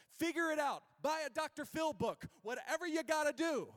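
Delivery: loud, high, barked orders; the harsh world. Acted. The world tells the weary to toughen up, shouting. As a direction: shout demands like an impatient drill coach. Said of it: "That's what the world says".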